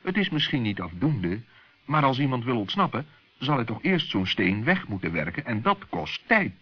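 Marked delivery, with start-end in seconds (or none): none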